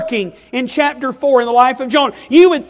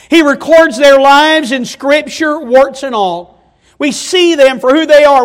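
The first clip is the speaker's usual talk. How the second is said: usual speaking voice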